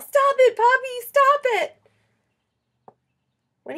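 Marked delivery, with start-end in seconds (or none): 0.0-1.8 much higher and louder squealing yell; child squealing at grandpa to stop spraying; gleeful yell. Acted. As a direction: shriek at grandpa with the hose. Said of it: "and I yell".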